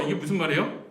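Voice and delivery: ordinary talk, nothing marked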